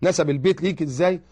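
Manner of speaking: normal talking voice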